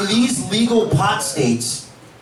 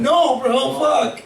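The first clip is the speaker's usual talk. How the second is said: mock anguish; 6 semitones higher, much slower than usual. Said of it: "your friend is crying".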